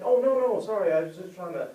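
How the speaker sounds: high, loud backpedalling; flustered apology. Acted. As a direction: hurriedly take it back, apologetic. Acted flustered apology in quarrel.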